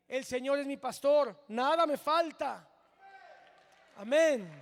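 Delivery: loud, rising declaration of faith; preacher proclaiming the psalm loudly to the church; proclaimed loudly. Shown as a demonstration. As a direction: call it out to the congregation with full force. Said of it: "Hoy por hoy podemos decir".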